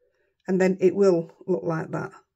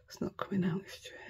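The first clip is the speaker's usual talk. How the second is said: whispered complaint under her breath; muttered to self; 15 dB quieter than usual. Real, not acted. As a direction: breathe it out to yourself, half-annoyed. Muttering to herself while crafting.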